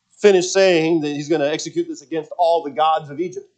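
ordinary talk

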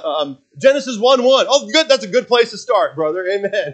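high, hammed-up pastor praise; high mock pastor banter; mock flustered reply. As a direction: play it as a sheepish dodge and cheery approval. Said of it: "And you're like".

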